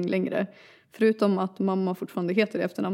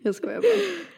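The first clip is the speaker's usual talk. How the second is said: laughing through words; 10 semitones higher than usual. Real, not acted. Fit of laughter.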